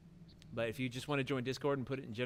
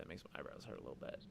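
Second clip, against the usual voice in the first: whispered aside; 10 semitones lower, 13 dB quieter, much faster than usual. Real, not acted. Aside about eyebrows hurting.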